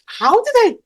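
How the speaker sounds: high, disbelieving squeak; incredulous. Real, not acted. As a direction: sound amazed at yourself. Disbelief at own past.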